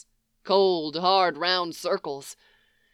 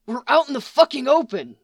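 fast, higher urgent protest; 6 semitones higher, 4 dB louder, faster than usual. A narrator's reading. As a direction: blurt it out in alarm. Panicked protest, caught in the open.

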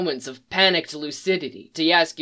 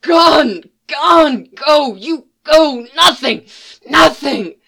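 shrieking wraith, much higher and louder; wraith screaming; 10 semitones higher, 9 dB louder, slower than usual. A narrator's reading. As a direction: scream each word like a tormented ghost. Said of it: "over his screams".

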